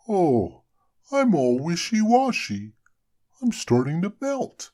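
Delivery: high, loud alarmed snowman; snowman exclaiming. A narrator's reading. Frosty exclaiming as the sun melts him. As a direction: cry out as you start to melt. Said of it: "Frosty exclaimed".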